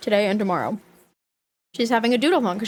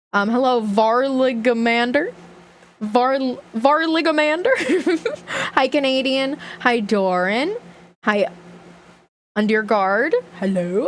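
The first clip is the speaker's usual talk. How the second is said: ordinary talk, nothing marked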